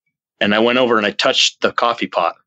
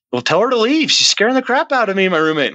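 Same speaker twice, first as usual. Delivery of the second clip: retold alarm; 11 semitones higher than usual. Retelling begging the medium for help, high and alarmed. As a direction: replay a scared plea, pitch way up.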